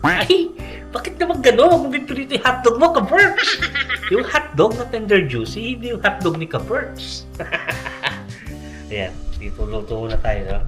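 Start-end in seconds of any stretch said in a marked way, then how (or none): 0.1-7.3 high, loud playful shriek; excited squeal. Real, not acted. Playful mock scolding about hotdog. 7.3-8.8 breathy laugh. Real, not acted. Laughs after the joke.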